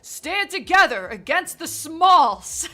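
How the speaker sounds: high, loud, slow put-on voice; mock character voice. Streamer jokes tall folk must unite, hammed-up voice. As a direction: ham up the talls' rallying call.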